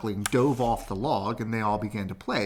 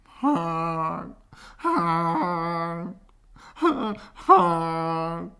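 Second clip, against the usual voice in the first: higher, loud sobbing honks; crying honks; 8 semitones higher, 5 dB louder, much slower than usual. Acted. Duckling crying with honks, loud and high. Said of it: "I was crying as the ugly duckling".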